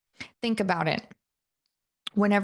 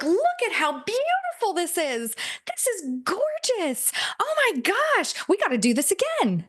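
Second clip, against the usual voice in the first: high, gushing, tipsy awe at the view; giddy tipsy delight; 10 semitones higher than usual. Retold or imitated. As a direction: gush over the lake like you've had three Bloody Marys. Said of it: "you're, you're drunk".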